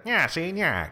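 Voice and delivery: put-on high, loud old-movie gangster; pushed-up, loud gangster impression; James Cagney. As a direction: punch it out like a forties gangster. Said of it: "of course James Cagney, the".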